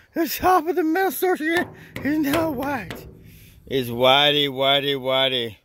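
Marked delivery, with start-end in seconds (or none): 0.1-3.1 very high squeaky falsetto; mock amazement. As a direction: gush in a silly high put-on voice. Mock awe at freshly whitened roof.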